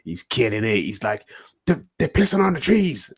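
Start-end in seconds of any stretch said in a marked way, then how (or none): 1.7-3.1 high, loud mock alarm; agitated mimicry. Guest imitating Alex Jones at Bohemian Grove, agitated. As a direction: exclaim it like a worked-up exposé host.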